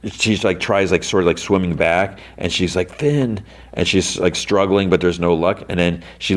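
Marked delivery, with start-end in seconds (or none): 2.9-3.4 high-pitched retold cry for a friend; mock calling out. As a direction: call the name higher, without volume. Struggling, calls out to Finn.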